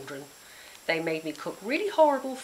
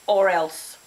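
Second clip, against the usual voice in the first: louder menacing threat; 8 dB louder than usual. Acted. Grizz threat.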